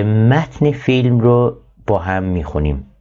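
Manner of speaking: normal talking voice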